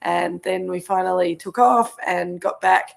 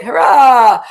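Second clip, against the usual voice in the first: cheering; 4 semitones higher, 10 dB louder than usual. Real, not acted. Cheering the show's start.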